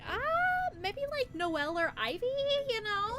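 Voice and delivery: quoted friend in a lifted voice; bright mock of the other person's suggestion; high mimic of friend. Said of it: "the person, other person's like".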